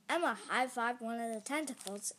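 plain reading voice

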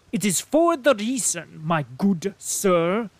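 high, loud German colonel; the stout German colonel insisting; loud, high-pitched indignant German officer; colonel's German accent. A narrator's reading. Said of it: "speaking with a German accent".

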